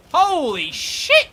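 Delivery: loud, high, drawn-out exclamation; slow, loud amazement; astonished outburst. Real, not acted. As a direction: stretch it out in shock. Many enemies coming in at once.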